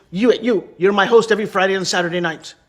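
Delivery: loud, emphatic hiring on the spot; mock club owner, punched out loud; imitated boss's order. Club owner picking a stranger as host, loud and commanding.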